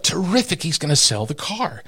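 high, eager inner voice; excited thought. Retold or imitated. Boy thinking the owner will sell his car, eager. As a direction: pitch it up with hope. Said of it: "And I thought".

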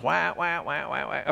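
soft imitated duck hail call; quiet wah wah duck calls; duck call honks. Shown as a demonstration. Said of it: "it does what we call a hail call".

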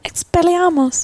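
high, slow wizard voice; hammed-up spell from Harry Potter; mock spell-casting. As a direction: cast it like a young wizard. Spell cast in voice.

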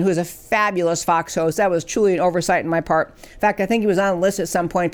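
ordinary talk

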